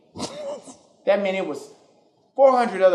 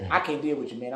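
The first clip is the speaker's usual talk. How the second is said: normal talking voice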